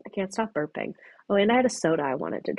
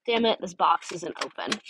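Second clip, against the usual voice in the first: usual speaking voice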